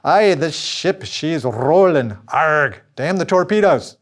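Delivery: slow, loud growly sea dog; pirate voice. Pretend. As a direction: ham it up like an old pirate. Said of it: "now you can start to speak like a pirate".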